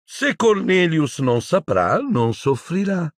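high, slow, sugary menace; smug villainess. Acted. Umbridge dismissing Hermione's objection, high smug drawl. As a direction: draw it out in a high put-on voice.

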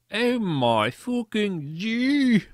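loud, high, drawn-out squeal; hammed-up fangirl scream. A narrator's reading. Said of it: "I screamed".

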